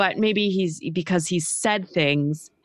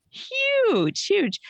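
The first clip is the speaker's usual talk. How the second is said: excited. Real, not acted. Excited emphasis on milestone.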